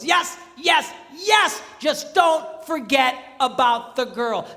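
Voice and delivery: rousing shouting preacher; preaching at full force. Real, not acted. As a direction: build to a rousing preacher's cry. Building preaching climax.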